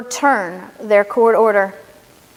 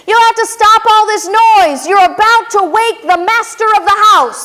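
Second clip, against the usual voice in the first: raised, scolding guard voice; stern sentinel; 8 semitones higher, 5 dB louder than usual. Acted. Sentinel scolding Revere at the door, loud and stern. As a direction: tell the rider off sharply. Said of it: "the sentinel said".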